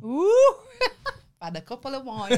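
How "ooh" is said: high loud squeal breaking into a laugh. Real, not acted. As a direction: let out a delighted shriek. Squealing after singing.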